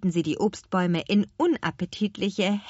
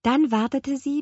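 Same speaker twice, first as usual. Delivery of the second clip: ordinary delivery, nothing marked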